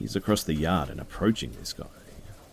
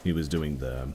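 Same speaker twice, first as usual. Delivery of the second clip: plain reading voice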